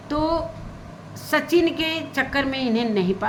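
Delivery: usual speaking voice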